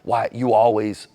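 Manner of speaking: a touch of a defensive snap; sputtering defensive comeback; imitating a heated partner cutting in; mock aggressive reply. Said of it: "an aggressive response from us".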